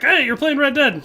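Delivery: high, loud excited wife voice; bright mock of his wife spotting the game; imitating wife. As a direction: mimic the wife's happy call. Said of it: "she was like".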